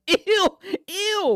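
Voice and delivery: loud, high squeal of disgust. Real, not acted. Streamer hearing his own clip, squealing in disgust. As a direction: recoil at your own voice.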